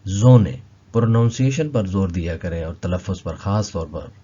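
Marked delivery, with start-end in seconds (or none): 0.1-0.6 ज़ोने said with a firm, louder z; model pronunciation. Shown as a demonstration. Teacher showing students how to read ज़ोने, louder. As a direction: say ज़ोने clearly, stressing the z. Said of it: "इसको पढ़ेंगे".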